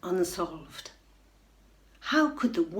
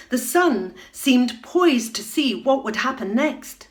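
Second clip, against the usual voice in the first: higher, louder climactic telling; excited narration; 4 semitones higher, 6 dB louder than usual. A narrator's reading. Narrator thrills at the whale's rescue, louder and higher.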